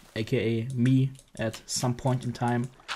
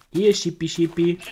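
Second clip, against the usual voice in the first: calling animals; 4 semitones higher, 4 dB louder than usual. Real, not acted. Calling sheep to him.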